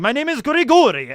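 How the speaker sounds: put-on high, loud, heavy Eastern European accent; imitating a player's thick Eastern European accent. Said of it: "laying on this Eastern European accent super thick".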